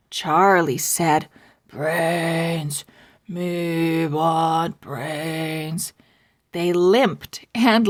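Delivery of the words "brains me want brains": zombie moan. Pretend. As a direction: drag it out like a hungry zombie. Charlie acting as zombie.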